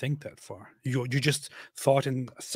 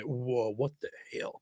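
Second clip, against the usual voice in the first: hushed shock; 9 dB quieter than usual. Retold or imitated. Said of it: "even they will say".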